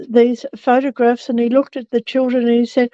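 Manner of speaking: ordinary talk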